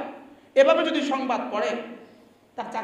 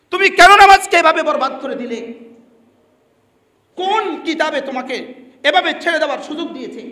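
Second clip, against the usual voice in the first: angry rebuke; 3 semitones higher, 8 dB louder than usual. Real, not acted. Preacher rebuking the imam who ruins prayer, angry shout. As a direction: thunder at the careless one.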